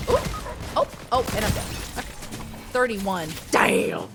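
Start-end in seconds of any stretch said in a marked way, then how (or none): 3.5-4.1 frustrated. Real, not acted. Dying in the game.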